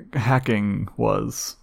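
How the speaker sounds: slow, with audible air quotes round it. Shown as a demonstration. To his cohost about boring news, hacking said in air quotes. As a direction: drag out the word as if in quotation marks. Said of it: "I could hear the quotation marks in your voice".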